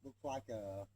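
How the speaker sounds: distant from the mic; calling from far away. Real, not acted. Speaker walks away from camera.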